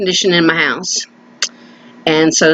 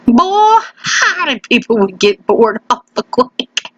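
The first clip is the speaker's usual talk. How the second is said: country voice; slower than usual. Acted. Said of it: "I do my country voice".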